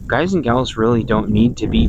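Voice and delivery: ordinary talk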